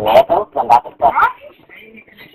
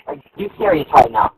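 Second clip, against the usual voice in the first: normal talking voice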